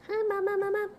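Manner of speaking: high, loud puppy voice for the dog; high loud voiced pet; dog's voice. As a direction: squeal it as the dog greeting Mama. Voicing the dog greeting.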